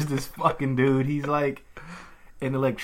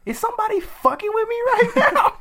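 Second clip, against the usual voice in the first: extremely high, squealing mock panic; paranoid outburst; 4 dB louder than usual. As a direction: imitate a freaked-out man, pitch through the roof. Electrician's paranoid outburst, acted out.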